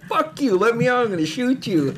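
high-pitched imitated threat, not shouted; mock threat. Imitating trapped friend threatening with staple gun, high mock anger.

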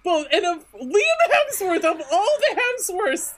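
high-pitched, giddy amazement; laughing disbelief. Real, not acted. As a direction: squeal it with a laugh. Amazed at the choice.

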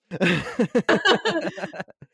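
high, loud cracking-up laugh; can't hold the laugh in any longer; burst of laughter. Real, not acted. Host breaking down reading to friends, high loud laughter. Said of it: "I'm trying not to crack up".

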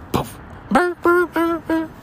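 imitating a small dog yapping at him; imitated yapping dog. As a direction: yap like a tiny dog. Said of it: "yipping and yapping".

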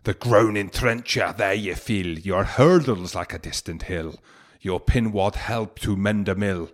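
slow, hammed-up Scottish accent for Burns. Reading Address to a Haggis to podcast listeners, in a put-on Scottish accent. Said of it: "A nice accent as well that you put on there".

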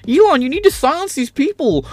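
high whining plea to Elon; Jordan Peterson impression. Streamer mocks Peterson pleading with Elon, high whine. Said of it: "Jordan Peterson impression".

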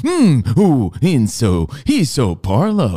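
slow, drawn-out mock admiration; mock swooning. Co-host mock swooning over ballplayer's exotic new name, slow. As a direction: coo it slowly, hammed up.

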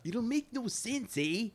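put-on high, jokey Canadian accent, half-unsure; mock Canadian accent. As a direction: toss off a goofy Canadian accent. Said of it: "I don't know what accent I'm doing".

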